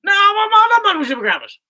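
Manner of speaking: very high, loud garbled squawk; hammed-up high garbled outburst; put-on comic voice. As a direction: protest in a mush-mouthed cartoon voice. Repeats nonsense bit higher.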